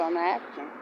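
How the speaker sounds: said low and soft under the breath; quiet self-talk. Retold or imitated. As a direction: tell yourself, barely aloud. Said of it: "I just kept telling myself".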